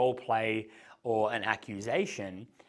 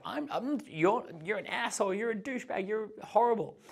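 higher, hammed-up girlish protest; mock girl's insults; 9 semitones higher than usual. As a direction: play the girl calling him names in fun. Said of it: "And she's like".

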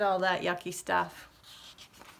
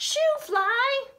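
put-on very high, loud girl's yell; shrill-pitched indignant girl yell; 5 dB louder than usual. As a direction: squeal it like an outraged little girl. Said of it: "A girl yelled".

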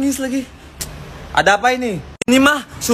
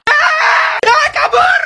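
very high-pitched squeal of a chase game; playful fleeing scream; much slower than usual. Pretend. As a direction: shriek and scatter in play. Players flee as chase game starts. (jeritan kabur pura-pura)

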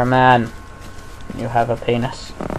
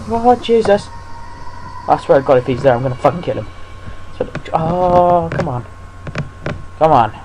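terrified horror victim; 3 semitones higher than usual. Real, not acted. Dreading going upstairs in game.

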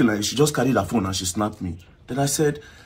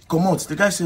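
usual speaking voice

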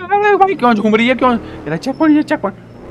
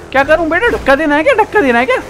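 higher, teasing sing-song questions; mock sweet teasing; 7 semitones higher, 3 dB louder, faster than usual. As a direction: talk down to the rival car like a child. Mock-sweet talk to rival car.

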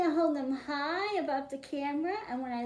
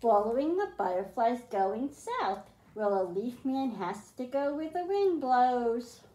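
put-on reading a picture book aloud to kids; storybook reading; 3 semitones lower than usual. A narrator's reading. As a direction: read it like a bedtime story for the class. Teacher reads picture book aloud.

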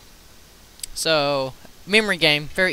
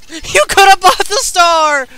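mocking yell; 9 semitones higher, 4 dB louder than usual. Real, not acted. Mocking player in game.

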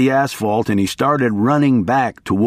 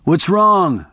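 a half-voiced yell, pitched up; yelled question; 6 semitones higher than usual. A narrator's reading. Narrator's mild yell at his attorney. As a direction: call out the question in alarm. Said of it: "I yelled".

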